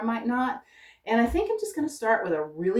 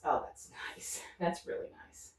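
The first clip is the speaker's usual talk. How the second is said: hushed admiration; 3 semitones lower, 11 dB quieter than usual. Real, not acted. Admiring paint mixing, voice dropped.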